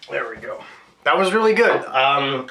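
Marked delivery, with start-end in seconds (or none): 1.0-1.8 higher and louder, delighted; really good, said with delight; excited praise. Real, not acted. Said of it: "I'll say it again".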